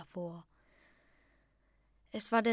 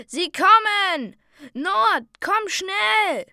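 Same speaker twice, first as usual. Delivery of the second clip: high, loud alarmed yell, slow; father yelling for his son in danger; frantic warning cry; urgent shout; 10 semitones higher, 13 dB louder, much slower than usual. A narrator's reading. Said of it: "rief mein Vater".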